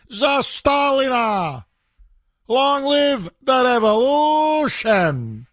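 slow, high mock revolutionary shout; hammed-up Soviet rally cry; fiery revolutionary speech. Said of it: "Or Stalin coming to San Francisco".

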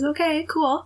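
high-pitched, easygoing okay; placating retold reply. Man placates her rambling.

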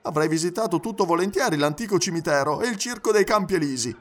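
high, animated valet's voice; talkative Passepartout, regretful. Acted. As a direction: recount the rushed visit in a lively high pitch. Character recounts rushed Paris visit.

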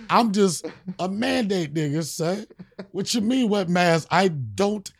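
ordinary delivery

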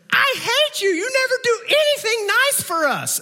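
put-on very high whiny kid wail; child's tantrum. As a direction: imitate a furious child yelling in a high voice. Voicing his kid's tantrum at Disney.

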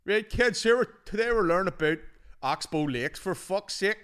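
loud, high rough-lad teacher; mock Northern Irish hard-man teacher; that guy's voice. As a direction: bellow at the class like an exasperated lad. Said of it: "That guy's voice".

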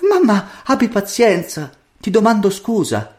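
high, meek daughter's voice; humble apology. A narrator's reading. Daughter apologising to her mother for being late, humbly. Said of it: "disse la figlia umilmente".

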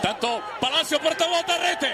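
high, excited goal call. Real, not acted. Goal against the keeper's error.

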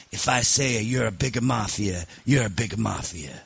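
mock Sicilian accent at natural pitch; Sicilian mob boss. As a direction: lay down the law like an old Sicilian don. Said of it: "Sicilian mob boss once".

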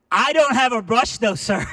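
high, full-volume holler; yelled reply. Retold or imitated. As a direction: shout it to someone hard of hearing. Still yelling to deaf man.

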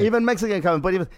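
ordinary talk

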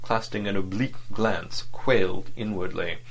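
ordinary delivery

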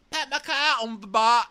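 high, loud squawked attempt at Kiwi; hammed-up, very high bad accent; botched New Zealand accent. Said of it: "like a drunk Mary Poppins".

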